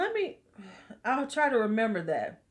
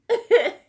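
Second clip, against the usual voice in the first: high loud cackle; shrieking laugh; 14 semitones higher, 11 dB louder than usual. Real, not acted. Cracking up at her own joke, a high squealing laugh.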